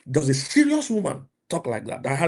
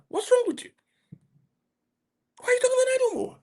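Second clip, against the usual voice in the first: much higher, fast, incredulous scolding; exasperated outcry; faster than usual. Real, not acted. Preacher scolding men who chase idle women, high incredulous outcry. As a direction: throw up your hands: why would you do this.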